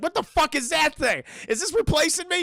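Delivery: hammed-up angry disbelief; very mad. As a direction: act out a jealous dog's outrage. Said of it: "He's very mad".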